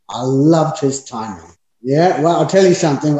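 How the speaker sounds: ordinary delivery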